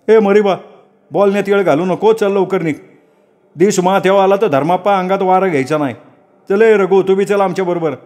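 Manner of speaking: high-pitched, impatient hurrying; urging villager. Acted. Villager hurries others to leave. (घाई करणारा, उंच आवाजातला गावकरी)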